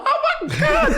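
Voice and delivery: high, loud laughing gasp; overjoyed laugh through the words; stunned joy breaking into laughter; laughing disbelief. Retold or imitated. Hearing he made the team.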